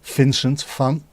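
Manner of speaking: "Vincent van" slowly as "Fincent fan"; Dutch V as F. Shown as a demonstration. Streamer drilling chat on the Dutch V, slow. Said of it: "V is pronounced as F".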